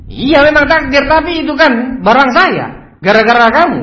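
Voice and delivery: acted furious protest; outraged boss. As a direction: blame the worker at full voice. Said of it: "Nggak bisa terima".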